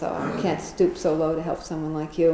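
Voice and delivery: ordinary talk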